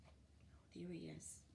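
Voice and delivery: hushed pointing; whispered aside. Real, not acted. Reader's aside to listener.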